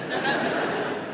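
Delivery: airy high-pitched giggle; giggling at the memory; high breathy giggle. Real, not acted. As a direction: let a squeaky laugh slip out. Laughs at own story.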